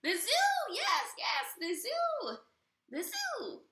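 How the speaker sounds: very high, loud thrilled witch; giddy witch eureka; excited witch. Acted. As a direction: squeal it gleefully.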